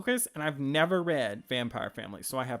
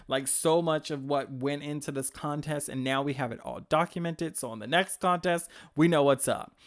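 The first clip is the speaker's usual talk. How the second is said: ordinary talk, nothing marked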